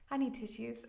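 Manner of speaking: real emotion welling up, ending in a laugh; getting emotional. Real, not acted. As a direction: apologize while tearing up. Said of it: "I get emotional".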